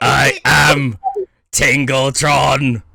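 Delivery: loud, slow, booming robot announcer; robot voice, drawn out and loud. Acted. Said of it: "You didn't even sound like a robot this time".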